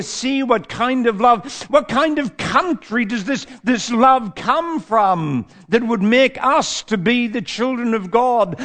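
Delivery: ordinary talk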